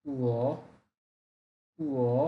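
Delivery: উও dipping low then rising; উও in the broken third tone; Mandarin third tone. Shown as a demonstration. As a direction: break উও with a low dip. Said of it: "third tone, এই জন্য ভেঙে বলতে হবে".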